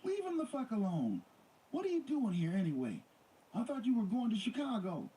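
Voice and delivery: hollering at him. A narrator's reading. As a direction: yell at him from the doorway. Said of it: "Nicole hollered".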